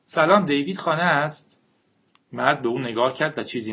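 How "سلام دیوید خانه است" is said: put-on higher, brighter-pitched sweet girl greeting; sweet maidenly voice. A narrator's reading. Jennifer greets David's father at the door in a sweet maidenly voice. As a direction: play the pure, lively young maiden at the door.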